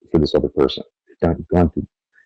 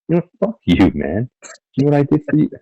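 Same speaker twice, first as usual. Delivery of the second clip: mock outrage; 9 semitones higher than usual. Spurned helper yells at the ungrateful partner in mock outrage. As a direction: play the spurned helper losing it, half comic.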